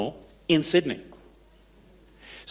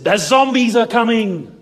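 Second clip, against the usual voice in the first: spooky prank announcement; 8 semitones higher, 6 dB louder than usual. Retold or imitated. Coworker springs the prank.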